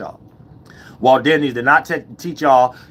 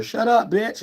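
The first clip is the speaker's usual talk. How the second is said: high-pitched playful command; playful, not negative; 10 semitones higher, slower than usual. Shown as a demonstration. Man telling a woman to hush, playfully high-pitched. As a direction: say it lightly, pitched way up. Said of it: "you ain't saying ... something negative".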